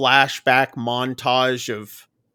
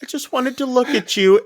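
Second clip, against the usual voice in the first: high-pitched, mock-weepy retelling; retold tearful plea; 11 semitones higher than usual. Finn's captor admits he came just to look, imitated as tearful. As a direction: plead as if starting to cry, lightly acted. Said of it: "And he starts crying".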